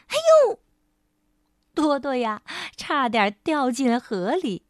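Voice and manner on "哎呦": high, loud squeal; sudden alarmed cry; startled yelp. Acted. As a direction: yelp as your foot slips. Mouse nearly falls in river.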